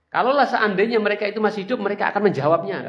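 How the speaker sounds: loud high triumphant jeer; gloating shout. Acted. Abu Sufyan gloating over the Muslims, loud jeer. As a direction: crow it loudly over the defeated. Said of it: "Ketika diteriaki seperti itu".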